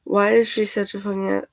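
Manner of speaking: ordinary talk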